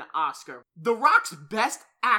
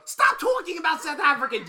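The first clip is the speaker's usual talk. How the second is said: loud, much higher shout to shut the topic down; real shout; 10 semitones higher, 7 dB louder than usual. Real, not acted. Shouting at his cohost to stop talking about South Africans. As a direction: yell over him with laughing exasperation. Cohost insults the foreign listeners.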